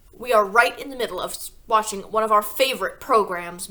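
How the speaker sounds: loud, put-out interrupted viewer; irritated Mr Wormwood. A narrator's reading. Father blocking the teacher at the door, loud and irritated. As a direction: grumble that the telly's on.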